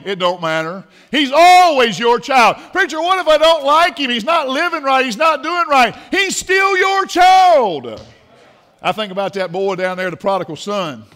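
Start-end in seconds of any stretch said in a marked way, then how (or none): 1.1-2.6 very loud, high and drawn out; emphatic preaching. Real, not acted. Preacher's emphatic repeat to an objection. 2.7-8.0 high, loud objection rising to a drawn-out shout; full-force preaching. Real, not acted.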